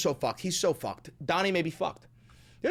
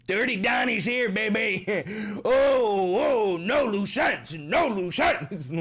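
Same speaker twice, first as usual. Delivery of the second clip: mock menacing villain, slow at the end; 4 semitones higher, 4 dB louder, slower than usual. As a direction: ham up a gloating killer, slowly. Imagined Trump killing Epstein.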